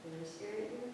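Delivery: hushed call to a spirit; talking to a ghost. Real, not acted. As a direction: softly ask the empty room. Calling out to a spirit.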